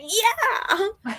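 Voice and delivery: high, gleeful cheer of yes; excited yell. Retold or imitated. Reader cheering a fighter's victory, high excited yell. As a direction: cheer it like a fan watching a win. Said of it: "I said, I was like".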